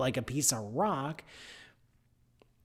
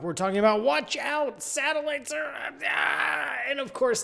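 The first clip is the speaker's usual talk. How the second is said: high teaser voice; 11 semitones higher than usual. Acted. Cartoonish teaser voice in intro.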